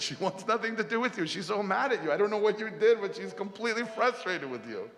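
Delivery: high-pitched, exasperated telling-off; scolding. Acted. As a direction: play the friend rebuffing him, voice way up. Friend scolding Krishna for upsetting Radha.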